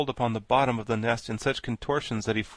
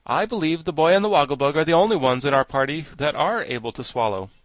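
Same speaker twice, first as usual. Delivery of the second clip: raised, projected character voice; louder storybook delivery; lightly voiced Scarecrow, pronouncing; 4 semitones higher, 8 dB louder than usual. A narrator's reading. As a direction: announce a considered opinion.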